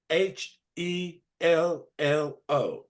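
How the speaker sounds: H E L L O spelled letter by letter, slow. Shown as a demonstration. Teacher spells H E L L O slowly for the class. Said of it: "Listen to the way I say it".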